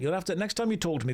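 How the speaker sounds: ordinary delivery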